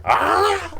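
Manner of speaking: high, loud mock war cry; mock yell. Imitating Batista yelling before the clothesline. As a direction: scream it as you swing. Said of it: "he yells like".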